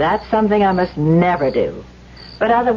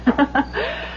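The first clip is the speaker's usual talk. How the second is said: soft airy giggle; a quick teasing giggle; light laugh; 7 semitones higher, 5 dB quieter than usual. Acted. Daphne laughing at Elwood, soft teasing giggle.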